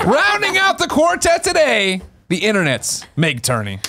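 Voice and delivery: slow, drawn-out host introduction; announcer intro. Acted. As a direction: announce the guest grandly.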